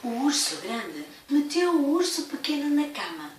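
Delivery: usual speaking voice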